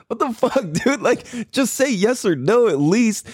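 high, incredulous what-the-fuck; mock-exasperated demand for a yes or no; exasperated outburst. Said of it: "I'm fucking, like, pissed".

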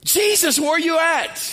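high-pitched frantic call for help; panicked calling out. Acted. Disciples in the storm searching for Jesus, panicked calling out. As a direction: shout out for someone missing in a panic. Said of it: "The disciples are going crazy".

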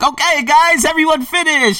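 high, loud call to the class; trainer calling out. Retold or imitated. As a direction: call out like a gym trainer ending the set. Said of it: "the trainer, he's like".

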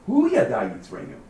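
high, loud "Hu" at the start; emphatic hu. Shown as a demonstration. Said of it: "in the emphatic".

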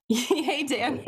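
laughing while saying hi; laughing greeting. Real, not acted. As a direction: greet him through a laugh.

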